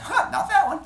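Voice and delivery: high, loud comic jolt; startled reaction. Acted. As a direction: yelp it as if a nerve was poked. Zeus reacts to brain squeezed.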